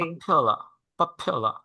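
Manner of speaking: পপিয়া with the first syllable pop pushed hard; stress on first syllable. Shown as a demonstration. Teacher showing student the stress in পপিয়া, first syllable pushed. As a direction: lean on the opening pop of পপিয়া. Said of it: "একটু জোর দিয়ে বলতে হবে".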